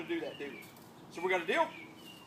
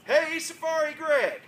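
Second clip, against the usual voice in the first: slow, loud, high greeting; cheerful callout; 6 semitones higher, 9 dB louder, slower than usual. Shown as a demonstration. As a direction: show kids how to shout it. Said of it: "all I want you to do is say".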